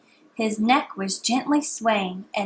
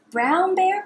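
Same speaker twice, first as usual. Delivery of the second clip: higher calling tone; sing-song call; 8 semitones higher than usual. Shown as a demonstration. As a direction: call it up high. Repeat call.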